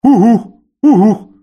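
high, loud hooting owl; booming owl call. A narrator's reading. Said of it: "но громким голосом".

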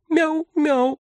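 high thin kitten meow; thin mew squeak. A narrator's reading. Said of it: "зачем-то повторила".

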